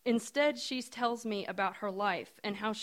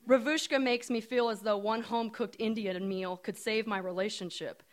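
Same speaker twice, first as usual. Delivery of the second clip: ordinary delivery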